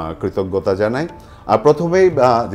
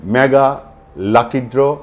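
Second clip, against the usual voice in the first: booming prize announcer; 4 semitones higher, 7 dB louder, slower than usual. Acted. Host opens the mega lucky draw.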